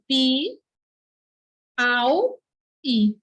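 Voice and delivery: "Pi, au, i" with a clear pause after each syllable. Shown as a demonstration. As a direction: separate "Pi", "au", "i" for students. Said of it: "Como é que se separa essa palavra?".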